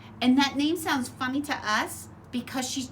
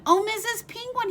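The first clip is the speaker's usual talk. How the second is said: higher, eager call; crying out; 12 semitones higher, 4 dB louder than usual. Acted. Little bird Choco calling to Mrs Penguin, eager and high. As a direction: call out hopefully, voice lifted up. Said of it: "he cried".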